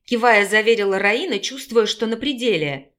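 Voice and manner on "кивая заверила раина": usual speaking voice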